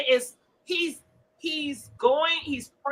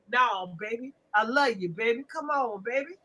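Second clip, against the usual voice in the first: put-on low, soft coaxing "baby"; mock abuser sweet-talk; 4 semitones lower, 7 dB quieter than usual. Host mimics an abuser sweet-talking his woman, low and soft. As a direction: coax her softly, lower. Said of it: "he'll be like".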